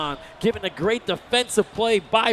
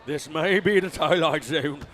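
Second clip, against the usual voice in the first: put-on British accent; 5 semitones lower than usual. Commentator doubts what he sees, British accent. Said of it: "when [name] starts to speak in a British accent".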